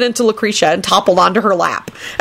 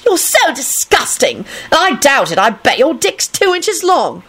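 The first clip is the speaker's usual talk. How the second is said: high, slow, scornful whine; disgusted shriek; 8 semitones higher, slower than usual. A narrator's reading. Rosie scoffing at Kenny's boast, shrill with disgust. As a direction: jeer at him in disgust. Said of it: "Rosie shrieked".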